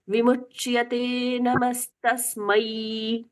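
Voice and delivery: slow chant, नमस्तस्मै ending in a clear a-i. Shown as a demonstration. Teacher chanting the correct ending, slow. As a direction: end नमस्तस्मै with a full a-i glide, slowly. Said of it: "दो स्वर सुनाई देते हैं".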